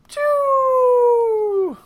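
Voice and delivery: high-pitched "choo" web sound; web shooting noise. Pretend. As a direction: shoot a web with your mouth.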